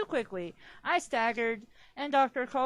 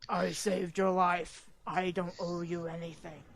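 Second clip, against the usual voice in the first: cold low voice; 5 semitones lower than usual. Acted. Edward's cold line, read first.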